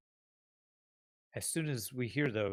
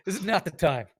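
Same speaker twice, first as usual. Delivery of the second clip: raised, louder exasperation, half laughing; testy Rev; 6 semitones higher, 5 dB louder than usual. Acted. Rev tells Doc not to start, mock exasperated. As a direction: cut them off, playfully irritated and louder. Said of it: "Rev's like".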